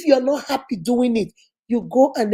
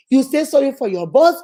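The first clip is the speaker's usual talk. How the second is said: ordinary talk, nothing marked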